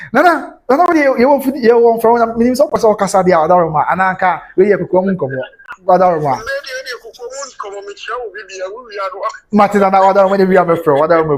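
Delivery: calling out loud. Real, not acted. Calling out loudly to Nana.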